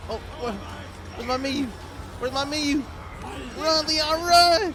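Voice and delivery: high, loud frantic urging; panicked yelling. Real, not acted. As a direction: scream at him to run.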